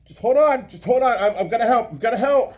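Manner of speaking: high, loud urgent reassurance; urgent mother. Acted. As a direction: reassure while straining to dig. Mother digging child out.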